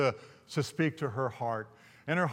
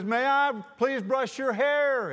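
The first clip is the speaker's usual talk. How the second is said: high, slow, raised-voice request; drawn-out, embarrassingly loud polite plea; speaking up loudly; 9 semitones higher, 4 dB louder, slower than usual. Retold or imitated. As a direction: call it out for someone hard of hearing. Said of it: "you're gonna have to speak up".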